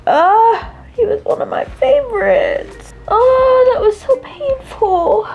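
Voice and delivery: high, tearful lament with small laughs; crying. Real, not acted. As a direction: grieve aloud through real tears. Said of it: "I'm crying my eyes out".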